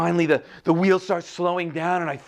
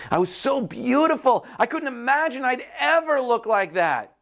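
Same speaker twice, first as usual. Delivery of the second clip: high, wonderstruck exclamation; amazed delight; 9 semitones higher than usual. A narrator's reading. Teacup seeing itself in the mirror, high amazed wonder. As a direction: marvel aloud, pitched well up.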